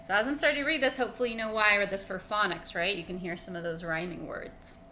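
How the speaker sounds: ordinary delivery, nothing marked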